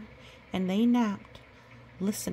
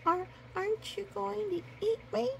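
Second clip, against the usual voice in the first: frightened cub; 12 semitones higher than usual. Acted. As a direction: ask it as a scared little cub.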